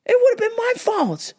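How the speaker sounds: way up high, anguished; high exasperated wail. Retold or imitated. Driver venting after near crash, high exasperated wail. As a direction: wail at how close it was. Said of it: "I'm like".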